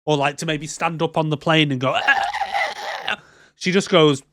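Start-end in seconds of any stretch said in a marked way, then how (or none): 1.9-3.1 drawn-out high mock choking croak; imitated choking. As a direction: choke theatrically for help. Acts out woman standing up choking.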